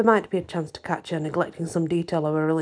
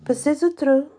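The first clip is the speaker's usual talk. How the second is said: lightly tossed off, pitched higher; offhand French retort, pitched up; nonchalant; 9 semitones higher, 3 dB louder than usual. A narrator's reading. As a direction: toss the French phrase off airily. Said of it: "with a nonchalant".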